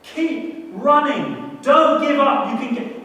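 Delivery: high, loud, very slow cheering; crowd screaming encouragement. Retold or imitated. The preacher voices crowds cheering a runner on. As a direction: yell it like a roaring marathon crowd. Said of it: "They're screaming".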